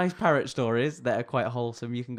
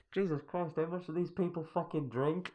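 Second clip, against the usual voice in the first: imitated binman; 6 semitones higher, 5 dB quieter than usual. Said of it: "you hear somebody going".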